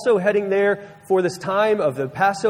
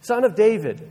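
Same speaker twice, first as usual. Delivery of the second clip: raised, mild calling-out; mock yell, pitched up; retold yell; 5 semitones higher than usual. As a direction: call out as if over a crowd, higher but only half-shouted. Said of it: "he yells and he says".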